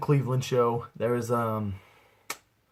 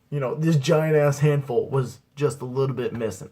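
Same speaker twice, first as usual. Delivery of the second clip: ordinary delivery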